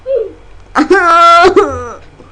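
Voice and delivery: loud villain cackle; evil cackle. Pretend. Risada maligna. (gargalhada maligna e alta)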